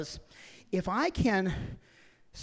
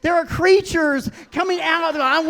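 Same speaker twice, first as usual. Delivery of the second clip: ordinary talk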